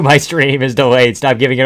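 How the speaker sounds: ordinary talk, nothing marked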